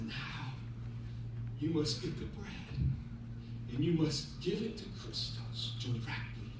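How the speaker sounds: low, soft, halting instruction; solemn counsel. Acted. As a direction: give each phrase room, gently and gravely. Wise rabbi gives solemn instruction.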